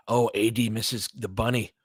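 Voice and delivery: ordinary delivery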